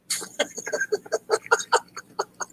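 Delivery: breaks into a high, helpless giggle; can't hold in the laugh; high squealing laugh. Real, not acted. To his viewers after his own dad joke, a squealing laugh.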